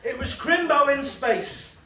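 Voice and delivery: loud, high, slow story opener; storyteller announcement. Acted. Comic telling his space Christmas tale to the crowd, in a big announcing voice. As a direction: boom out the start of the tale.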